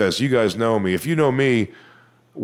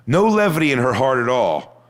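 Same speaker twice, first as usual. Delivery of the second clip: normal talking voice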